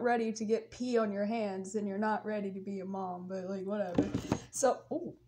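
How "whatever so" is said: ordinary talk, nothing marked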